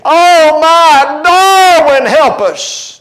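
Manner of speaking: high, drawn-out mock cry for help; mock panicked cry; imitated screaming. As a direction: wail it out like a panicked caller, hammed up. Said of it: "they're screaming and crying out".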